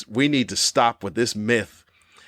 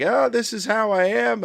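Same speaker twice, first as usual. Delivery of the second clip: mock old person; 10 semitones higher than usual. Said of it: "old people are like".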